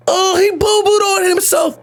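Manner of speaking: high-pitched mocking kid voice; jeering schoolkid. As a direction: taunt like a kid pointing someone out. Acted jeering kids in the hallway.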